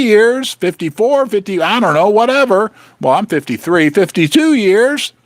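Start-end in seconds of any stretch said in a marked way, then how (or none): none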